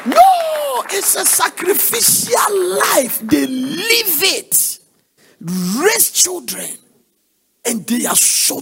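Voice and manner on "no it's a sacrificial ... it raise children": high, slow, tearful; moved to tears while preaching; emotional crying preaching. Real, not acted. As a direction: let the voice break as you drag it out. Preacher moved about sacrifice.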